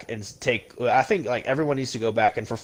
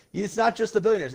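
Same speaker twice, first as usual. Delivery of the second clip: ordinary talk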